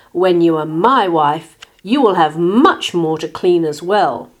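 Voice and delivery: loud, forceful pig's threat; indignant snorting. A narrator's reading. Pig threatens his bride-to-be, indignant snorting. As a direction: lay down the law loudly. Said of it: "he snorted".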